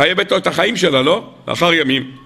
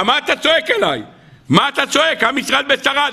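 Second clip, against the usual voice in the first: high-pitched challenging question; acted rebuke; 7 semitones higher than usual. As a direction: act out chiding someone for crying out, pitch raised. Acted divine rebuke of praying Moses. (נזיפה מוצגת בטון גבוה)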